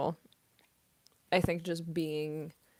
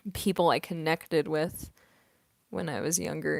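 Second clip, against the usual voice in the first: normal talking voice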